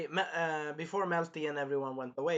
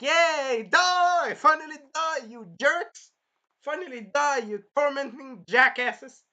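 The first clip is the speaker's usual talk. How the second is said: high, loud gleeful yell; gleeful cheering; 12 semitones higher, 11 dB louder, slower than usual. Retold or imitated. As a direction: cheer their execution with glee. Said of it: "And I'm like".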